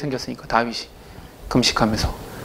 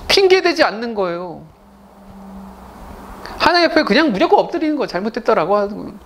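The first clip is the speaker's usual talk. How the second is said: rising fervent sermon voice, higher; impassioned preaching; 8 semitones higher than usual. Acted. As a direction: preach it with mounting conviction. Preaching climax.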